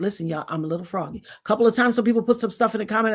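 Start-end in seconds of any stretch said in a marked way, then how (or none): 0.0-1.2 quoting herself. Retold or imitated. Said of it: "And I even said it".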